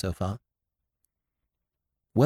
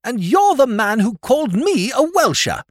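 put-on indignant young lord; 5 dB louder than usual. A narrator's reading. Lord Drever rounding on Sir Thomas, outraged. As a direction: drag it out at the old knight. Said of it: "added his lordship".

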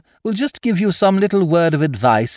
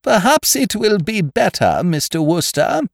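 cold and even; 12 semitones higher, 5 dB louder than usual. A narrator's reading. Headmistress cutting Wooster off, cold and even. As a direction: cut him off, cold and level. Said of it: "she said in a cold, even voice".

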